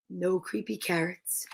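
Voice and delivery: ordinary delivery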